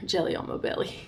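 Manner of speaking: quiet giggly repeat of a silly phrase; amused, hushed aside tipping into laughter; giggling. Real, not acted. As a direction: say it softly, breaking into giggles. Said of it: "I'm so immature, guys".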